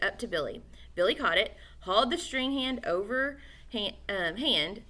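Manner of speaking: plain reading voice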